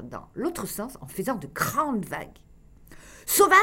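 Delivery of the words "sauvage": high, loud furious yell; yelled insult. A narrator's reading. Loul yelling at the tourist boat rocking him, furious yell. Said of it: "hurla Loul".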